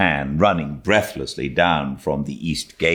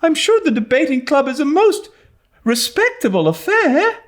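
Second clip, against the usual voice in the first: put-on much higher, quick girl's plea; pleading Anne; 5 dB louder than usual. A narrator's reading. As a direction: coax like an earnest young girl. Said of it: "pleaded Anne".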